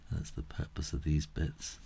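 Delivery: breathy, toneless murmur to herself; whispered aside. Real, not acted. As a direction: drop to a whisper, half to yourself. Aside after unsure explanation.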